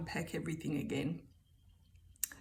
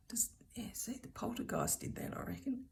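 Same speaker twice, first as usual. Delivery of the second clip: soft, conspiratorial whisper; whispered aside; 4 semitones higher, 7 dB quieter than usual. Real, not acted. To viewers, blaming the poltergeist for Siri, a whisper. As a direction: lean in and hush it like a secret.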